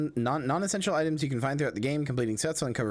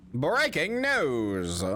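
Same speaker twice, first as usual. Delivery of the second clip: slow, drawn-out newsboy cry; 1920s newsboy voice; 3 semitones higher, much slower than usual. Retold or imitated. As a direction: shout it like a street newsboy. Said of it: "I love the nineteen twenties newsboy voice".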